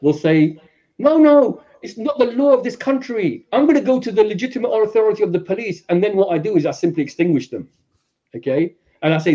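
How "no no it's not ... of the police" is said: alarmed protest. Acted. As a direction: object sharply, pitched high. Victim objecting and threatening police.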